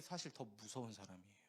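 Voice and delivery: hushed whispered warning. Real, not acted. Pastor whispering the warning to the congregation, hushed. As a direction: drop to a whisper for the punchline.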